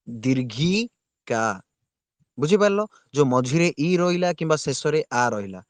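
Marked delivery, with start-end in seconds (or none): 0.1-1.4 ଦୀର୍ଘିକା very slow, ଘି held long and rising before କା; stretched middle i. Shown as a demonstration. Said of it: "ମଝିରେ ଇ ଉଚ୍ଚାରଣ ହଉଛି". (ଦୀର୍ଘିକାର ମଝି "ଇ" କୁ ବହୁତ ଲମ୍ବେଇ)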